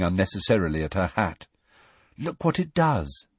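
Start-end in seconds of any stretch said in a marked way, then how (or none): 2.2-3.3 dreamy Luna. A narrator's reading. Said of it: "vague and dreamy voice".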